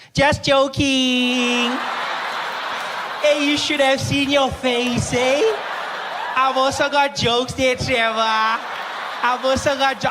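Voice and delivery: loud, high, drawn-out teasing shout; gleeful joker. Retold or imitated. Pharmacist springs a prank on customer, gleeful high shout. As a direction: crow it slowly, high and loud. Pharmacist reveals the cruel joke.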